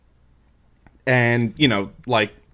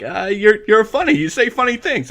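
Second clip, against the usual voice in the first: much higher, flustered and nervous; nervous Henry; 10 semitones higher, 3 dB louder than usual. Retold or imitated. Henry nervously backpedals to Tommy, high and flustered. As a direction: stammer it out, scared to offend. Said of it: "It's like".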